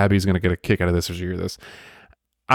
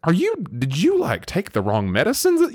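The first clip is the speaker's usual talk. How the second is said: incredulous mock; 7 semitones higher than usual. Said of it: "You'd be like".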